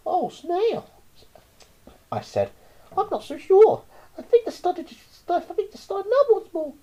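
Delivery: put-on high child's voice; a nervous kid; worried child. A narrator's reading. Child doubts the snail's plan, high.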